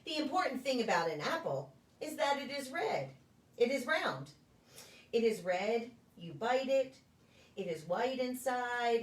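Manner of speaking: gentle, sing-song picture-book reading with a lilting refrain; reading to children. Acted. Preschool story time reader to the children, gentle storybook voice. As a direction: read it like story time, drawing the little ones in.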